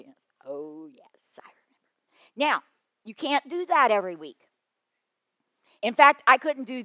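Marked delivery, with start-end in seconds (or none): 0.4-1.8 whisper. Retold or imitated. Grown kids recalling the day.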